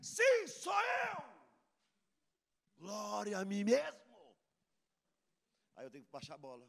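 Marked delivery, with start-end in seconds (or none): 0.0-4.0 high, slow, booming self-glory; mock boastful shout. Pastor mocking himself answering a girl's praise, hammed-up shout. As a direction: ham up a proud pastor's boast. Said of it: "me dá vontade de falar".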